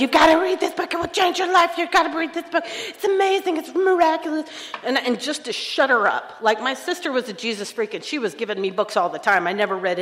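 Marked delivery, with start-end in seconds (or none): 0.0-4.5 much higher, eager insistent gush; gushing pushy friend. Retold or imitated. The pushy AA friend pressing her book, gushing high. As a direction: gush like a friend who won't shut up. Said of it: "You got one of those friends".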